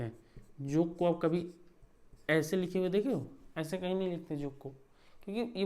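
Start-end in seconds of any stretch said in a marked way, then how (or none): none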